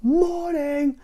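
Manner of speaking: very high, loud "Morning!"; shouted greeting. Acted. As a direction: holler it through the door. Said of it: "shouted the postman".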